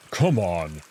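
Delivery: low curt command; gruff wolf voice. Acted. Wolf telling Marco to follow, curt. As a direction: order him along.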